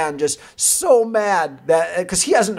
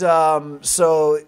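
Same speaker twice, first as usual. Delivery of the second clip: ordinary delivery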